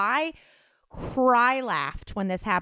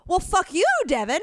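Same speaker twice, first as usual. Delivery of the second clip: higher-pitched imitated retort; mock angry comeback; 8 semitones higher than usual. Customer cursing back at the caller, mock angry. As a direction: throw the insult back, hammed-up. Acting out her curse back.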